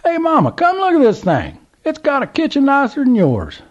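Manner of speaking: put-on high, loud old man holler; yelling to Mama. A narrator's reading. Old man hollers to his wife from the truck, loud. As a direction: call out the back of the truck. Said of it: "and yelled".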